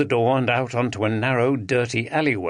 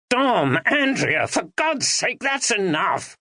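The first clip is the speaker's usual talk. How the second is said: shouted; 9 semitones higher, slower than usual. A narrator's reading. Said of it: "shouted Ben".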